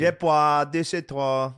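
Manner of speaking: high, slow mock French. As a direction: stretch out fake French seductively for friends. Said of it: "keep talking French".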